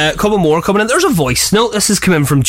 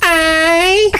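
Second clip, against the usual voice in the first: put-on high Derry accent cat voice; a hint of a Derry cat; 11 semitones higher than usual. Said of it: "Derry cat".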